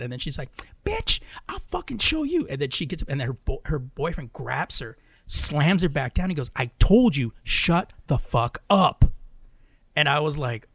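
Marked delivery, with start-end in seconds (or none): 0.8-2.5 high-pitched imitation of an angry woman; squeaky retold quarrel outburst; mock threat. 6.5-9.0 loud, slow, emphatic telling-off; imitating a fed-up boyfriend laying down the law; angry command. Boyfriend slams her down, retold.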